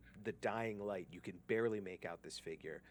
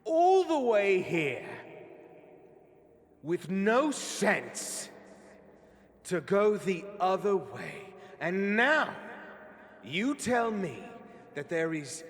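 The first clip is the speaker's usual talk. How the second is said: high, loud enraged tirade at a failing underling; villain berates a cowering driver in fury; furious villain shout; 13 semitones higher, 15 dB louder than usual. Acted. Leader screams at carriage driver, furious shout.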